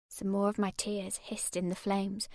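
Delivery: ordinary delivery, nothing marked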